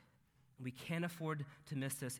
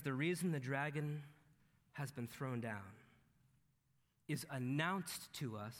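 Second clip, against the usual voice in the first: normal talking voice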